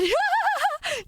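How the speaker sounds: sudden squealing laugh; cracking up in a high squeal; high burst of laughter. Real, not acted. Laughing at a chat joke.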